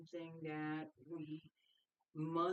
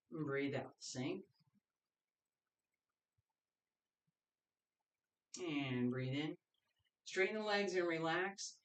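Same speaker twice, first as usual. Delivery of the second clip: calm breathing cues. Acted. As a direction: count the class through each breath. Leads paced breathing cues.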